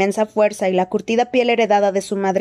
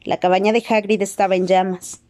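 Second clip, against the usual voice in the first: ordinary delivery, nothing marked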